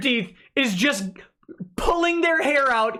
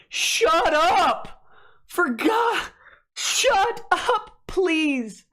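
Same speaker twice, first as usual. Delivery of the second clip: high, strained mock outburst; desperate begging; 6 semitones higher, much slower than usual. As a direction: imitate frantic bosses begging for silence. Voiced executives begging for silence.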